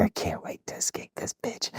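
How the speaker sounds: bitter whispered aside. Retold or imitated. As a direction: say it so the DIs can't hear. Recruit's silent fury.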